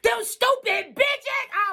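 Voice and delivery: high, loud, slow wail through tears; crying outburst. Retold or imitated. Cursing the Joneses while breaking down, wailing. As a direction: burst out sobbing the insult. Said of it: "I bust out crying and said".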